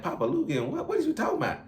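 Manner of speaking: baffled, what are you even saying; replying to a strange coworker, baffled and high; confused. Retold or imitated. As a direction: voice jumps way up in disbelief. Confused reply to odd slang.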